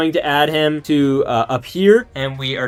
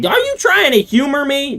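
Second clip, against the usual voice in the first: high, fast bragging Florida man; boastful Captain Florida; 12 semitones higher than usual. Acted. Toy collector voicing a figure, high boastful brag. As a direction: puff up and brag like a self-made hero.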